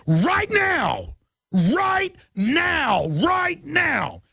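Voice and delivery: right now yelled high, again and again; shouted urgency. Real, not acted. Host urging folks to act at once, shouting. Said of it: "escalating repeated urgency".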